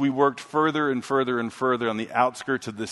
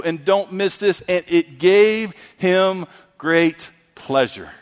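raised, slow, emphatic preacher's reading; scripture reading; 5 semitones higher, 4 dB louder, slower than usual. Real, not acted. Preacher reading Ephesians to the church, slow emphatic proclamation. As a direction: proclaim the verse slowly in a higher voice. Reads a scripture passage aloud.

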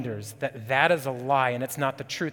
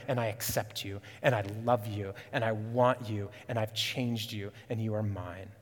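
tender voice of God; 3 semitones lower than usual. Acted. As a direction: say it like a father holding a child. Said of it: "God is saying".

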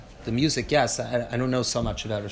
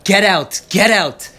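loud, high, indignant outburst ending in shouts; outraged rabbi rebuking a missionary; angry yelling; 6 semitones higher, 8 dB louder than usual. Retold or imitated. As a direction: rage at the insult to the martyrs. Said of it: "And he yells at them".

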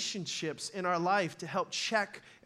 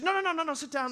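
wife stopping him; 7 semitones higher, faster than usual. Retold or imitated.

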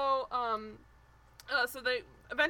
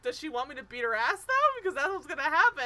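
mock taunt; 4 semitones higher, 8 dB louder than usual. Retold taunting quote from Coral.

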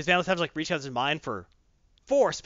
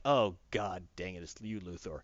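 grumbling villain; 9 semitones lower, 8 dB quieter than usual. Retold or imitated. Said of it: "He's like".

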